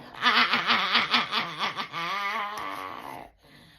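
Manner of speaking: loud high cackling laugh; loud cackle. Real, not acted. Laughing at her own impression of daughter, loud cackle. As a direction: burst out cackling.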